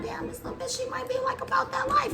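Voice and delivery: high, hushed aside; imitated whisper. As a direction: whisper it nervously to yourself. Inmate's thought, whispered.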